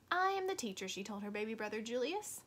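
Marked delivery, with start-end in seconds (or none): 0.1-0.9 a child playing teacher, pitched higher; bossy little teacher announcing herself. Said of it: "Lily pretended to be Mister Slinger".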